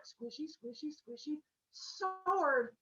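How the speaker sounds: high, drawn-out goofy chant; silly high singsong; squishy forging noise voice. Acted. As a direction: croon the sword into being. Cyclops forging a sword.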